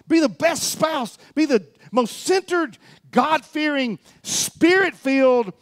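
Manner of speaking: high, impassioned exhortation; full-force preaching. Real, not acted. Preacher urging the church to work for the Lord, fired-up preaching. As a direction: drive it home to the congregation, voice lifted.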